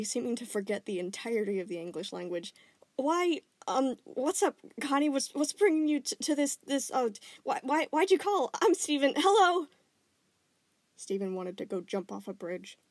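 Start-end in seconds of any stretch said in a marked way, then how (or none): 3.0-9.7 high, loud nervous babbling; flustered stammer. Acted. Flustered boy babbles nervously at his crush. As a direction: stumble over every word.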